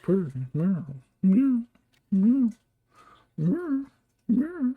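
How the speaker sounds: high, repeated mock meows; imitated cat trilling. Owner mimicking his cat circling his feet, high trilled meows. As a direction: mimic a pestering cat, pitch climbing high.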